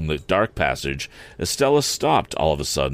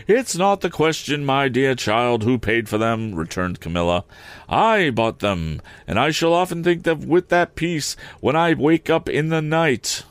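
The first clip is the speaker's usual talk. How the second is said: higher, plaintive complaining lady; fussy lady; 6 semitones higher than usual. Acted. Camilla complaining to the relatives in a higher plaintive voice. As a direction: whine it higher like a fretful society lady.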